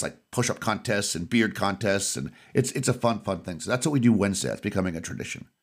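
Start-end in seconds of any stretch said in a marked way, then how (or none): none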